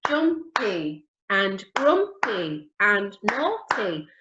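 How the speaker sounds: slow, beat by beat through the words; teacher slowing down so kids can clap; drawn out for clapping; slow syllable modelling. Shown as a demonstration. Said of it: "Let's try it with".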